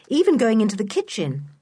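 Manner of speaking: ordinary delivery, nothing marked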